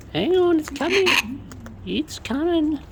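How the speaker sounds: high pet-talk voice; high sing-out to pets; talking to birds. Real, not acted. Telling eager birds food is coming.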